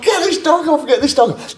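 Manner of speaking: high-pitched, urgent mock yell; panicked shout. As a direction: imitate a man yelling for help. Acts out friend attacked by dog.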